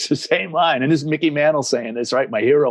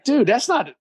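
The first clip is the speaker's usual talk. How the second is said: higher-pitched offended protest; mock-offended pushback, pitched up; 8 semitones higher, slower than usual. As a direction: retell it as an indignant, high-pitched objection. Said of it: "And I got offended".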